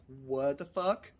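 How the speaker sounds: retelling the shock at the vet; stunned disbelief. Retold or imitated. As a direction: drag out the disbelief. Shocked at dog size.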